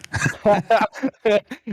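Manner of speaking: high burst of amused laughter; real laugh. Real, not acted. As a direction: laugh at your own blunder. Co-caster laughs at own misjudged call.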